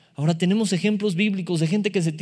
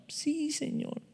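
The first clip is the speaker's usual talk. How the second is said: soft reply; 10 semitones higher, 11 dB quieter, much slower than usual. Acted. Peter answering again.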